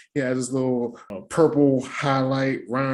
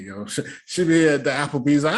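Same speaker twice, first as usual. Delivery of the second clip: ordinary delivery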